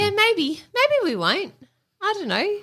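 lilting high uncertainty; high unsure. Real, not acted. Unsure about a break.

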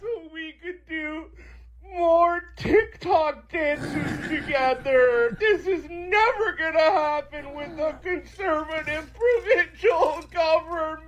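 hammed-up crying wail; mock sobbing. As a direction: wail it like a fake crybaby. Parody crying liberal.

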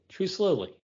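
usual speaking voice

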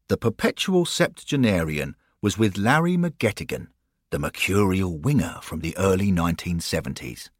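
ordinary delivery

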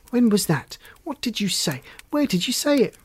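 high, insistent interrogation, rising in pitch; excited grilling; firing questions. A narrator's reading. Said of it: "fire questions at her like that".

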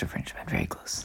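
breathy whispered aside. Real, not acted. Guest whispering a joke to the interviewer.

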